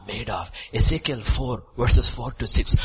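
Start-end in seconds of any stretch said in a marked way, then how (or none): none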